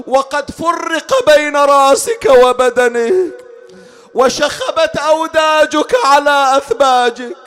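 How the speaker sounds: high grieving plea swelling loud and slow; impassioned lament. Acted. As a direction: build from high pleading to a loud, stretched wail. Lament addressed to slain Hussein.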